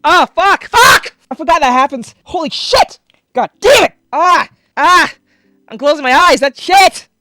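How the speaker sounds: loud high screams and curses at the gore; horrified yelling. Real, not acted. Reacting to an eye getting slit, horrified yelling. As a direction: yell and swear as you flinch away. Watching eye-slitting scene.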